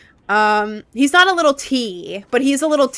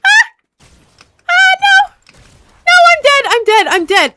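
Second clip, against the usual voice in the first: high, loud cries, fading down; despairing shout; 8 dB louder than usual. Real, not acted. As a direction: wail at dying in the game. Killed in game.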